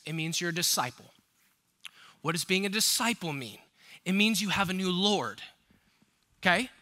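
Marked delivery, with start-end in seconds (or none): none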